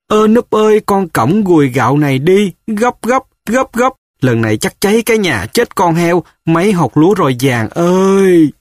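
put-on high, urgent old mother calling her son. A narrator's reading. Frightened mother urging her son to flee, high calling. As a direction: cry out to Núp in a panic, voice pitched high. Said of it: "Mẹ kêu".

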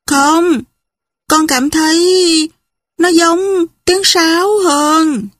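put-on child's reply. A narrator's reading. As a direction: answer slowly and high, as a child would. Child answers father in story. (giọng trẻ con, cao và chậm rãi)

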